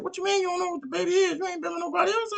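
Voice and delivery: put-on high, squeaky falsetto girlfriend voice, aghast; shocked disbelief. Girlfriend reacting to the gossip, high shocked squeal. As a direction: squeal it in disbelief like a scandalized friend.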